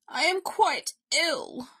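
put-upon sick bear; ailing bear. A narrator's reading. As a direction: insist on how poorly you are. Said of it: "Bear reminded him".

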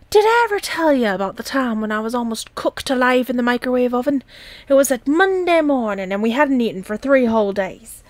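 put-on an old uncle launching into a tall tale; rambling old uncle. A narrator's reading.

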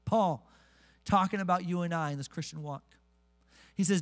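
ordinary delivery

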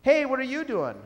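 light mock call of hello; friendly call. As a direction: call out cheerfully to the third worker. Frenchman hails the third worker.

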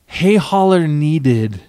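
usual speaking voice

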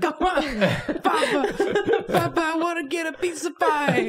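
put-on very high kid voice, Brooklyn accent; squeaky Brooklyn little girl; Brooklyn Anya. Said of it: "Anya from Brooklyn".

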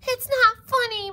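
high, loud protest; high-pitched yell; yelling mouse. A narrator's reading. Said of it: "Mouse yelled".

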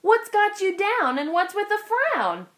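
a hint of a bright, kind driver; cheerful bus driver. A narrator's reading. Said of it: "Joy asked".

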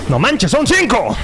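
real shock rising to a yelp; alarmed outcry. Real, not acted. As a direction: cry out as the enemies appear. Outnumbered by enemies.

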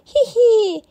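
put-on a toddler's gleeful giggle; giggling. A narrator's reading. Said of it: "George giggles".

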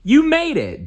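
high delighted nephew shout; excited exclaim. A narrator's reading. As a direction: burst out with relief. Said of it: "Dewey exclaimed".

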